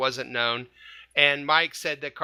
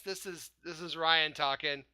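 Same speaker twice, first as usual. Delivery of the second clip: ordinary delivery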